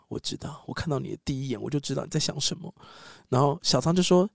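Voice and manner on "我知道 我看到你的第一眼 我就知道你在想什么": soft, near-whispered reply; hushed calm. Acted. Ashitaka calmly answering the furious San, hushed.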